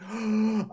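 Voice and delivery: excited gasp. Acted. Player voicing Wraith greeting Alda.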